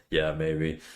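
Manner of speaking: low flat robot voice. Acted. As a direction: sound like text to speech. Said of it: "When did you get text to speech?".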